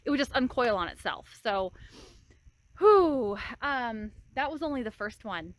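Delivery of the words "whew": loud exhaled whew. Real, not acted. Exhausted after wire work.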